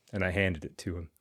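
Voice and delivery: ordinary delivery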